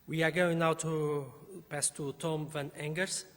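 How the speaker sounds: ordinary delivery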